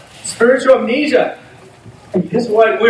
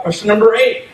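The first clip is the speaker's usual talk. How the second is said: usual speaking voice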